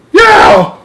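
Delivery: loud, very high giant's yell; roar of pain. Acted. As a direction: roar it out at full voice. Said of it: "roared the flesh lump eater".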